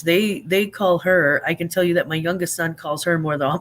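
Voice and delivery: ordinary talk, nothing marked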